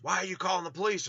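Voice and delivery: high, quick retold challenge; confronting neighbor.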